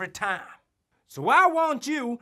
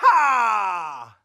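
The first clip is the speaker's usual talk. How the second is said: yeehaw; 6 dB louder than usual. Acted.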